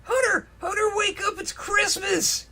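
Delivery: high, excited wake-up shout; excited cheer. Acted. Friend on Christmas morning, high excited shout. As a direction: bounce on the bed yelling him awake. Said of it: "he cheered".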